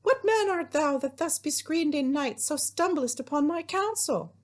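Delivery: high-pitched surprised maiden; Juliet. Acted. Teacher playing Juliet surprised by a hidden visitor, high put-on voice. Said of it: "my best Juliet voice".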